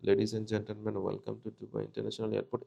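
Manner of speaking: low, very soft monotone reading; small voice. Shown as a demonstration. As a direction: shrink the announcement down, barely projected. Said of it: "মানে ছোট করে পড়া যাবে না". (নিচু গলায় ছোট করে পড়া)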